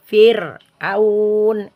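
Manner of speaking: Fir'aun, light forward r; r kept thin. Shown as a demonstration. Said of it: "suara itu keluar di luar".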